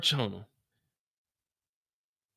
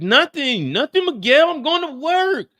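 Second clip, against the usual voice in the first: high, loud shrill-free denial; defensive sister; 11 semitones higher, 7 dB louder than usual. Retold or imitated. Mireya snapping at her brother Miguel, high and loud. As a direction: brush him off, up high.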